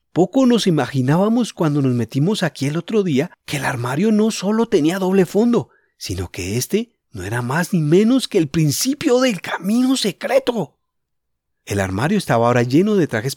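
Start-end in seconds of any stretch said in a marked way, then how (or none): 0.1-10.8 inner voice, raised and eager; thinking it through with excitement; boy's private thoughts voiced higher; Julián thinking.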